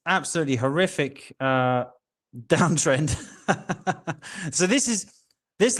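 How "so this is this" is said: laughing. Real, not acted. Laughs at horrific downtrend.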